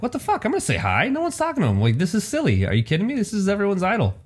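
squeaky high-pitched disbelief; high mock outrage. Telling friends how he reacted to being ignored, high indignant squeak. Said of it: "I'm like".

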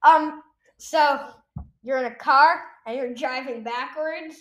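ordinary talk

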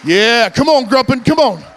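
high, loud shouts of come on; cheering on a teammate. Real, not acted. Strongman urging Gruppen on during the brick break, shouting. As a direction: push your partner through the break.

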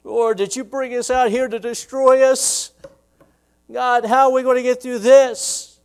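high, loud desperate plea; crying out. Retold or imitated. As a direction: cry out to God in anguish. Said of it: "he's crying out".

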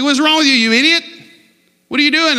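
high, loud mock road rage; irritated yelling. Driver to someone cutting in, irritated yelling. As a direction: yell at the driver who cut you off. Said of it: "You yell at them".

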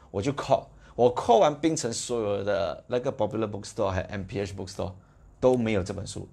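usual speaking voice

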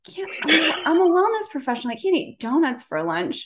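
mock outraged self-talk about lunch; indignant protest. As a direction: object as the strict wellness professional. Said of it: "And I was like".

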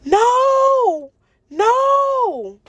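high, crying no as the car flips; desperate cry. Retold or imitated. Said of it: "all I remember saying".

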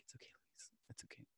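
barely audible, soothing whisper; hushed imitation of a consoling teacher; comforting whisper. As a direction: whisper reassurance to someone crying in your arms. Teacher comforting crying student, retold.

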